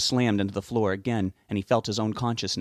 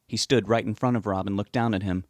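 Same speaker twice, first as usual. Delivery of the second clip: ordinary delivery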